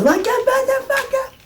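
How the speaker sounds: mock shriek, very high and strained; imitating a scream. Reviewer mimics the guitarist's screamed line, very high mock shriek. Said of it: "Or he says".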